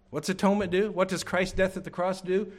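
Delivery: ordinary delivery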